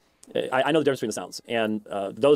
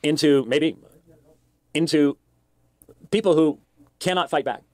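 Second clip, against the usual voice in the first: halting delivery; 4 semitones higher, 3 dB louder than usual. Real, not acted. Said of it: "he's so punctuated".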